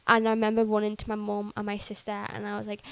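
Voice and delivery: ordinary talk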